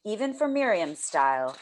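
ordinary delivery, nothing marked

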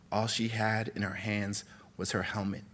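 plain reading voice

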